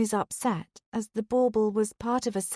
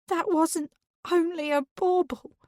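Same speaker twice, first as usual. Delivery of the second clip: high, tearful protest; a touch of a sob in a high voice; 9 semitones higher than usual. A narrator's reading. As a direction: choke it out through tears. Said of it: "Dawn begins sobbing".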